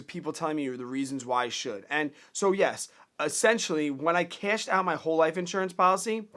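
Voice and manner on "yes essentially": ordinary talk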